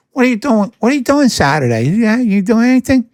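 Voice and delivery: high, sing-song fishing voice; mock wheedling friend. Imitating a friend angling for a favour, high sing-song. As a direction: fish for a favour in a put-on sweet tone.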